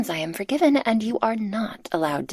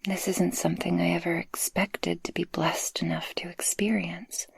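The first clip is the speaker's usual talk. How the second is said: tender whisper. Acted. Speaker confessing love to the listener, tender whisper. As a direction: open your heart in a hush.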